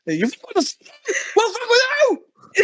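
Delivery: laughing shout. Acted.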